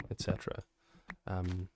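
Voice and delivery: ordinary talk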